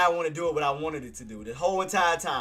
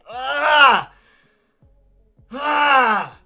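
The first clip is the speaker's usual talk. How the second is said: loud anguished yell out; frustrated yell; 7 semitones higher, 9 dB louder than usual. Real, not acted. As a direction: shout out in frustration. Trade moves after market close.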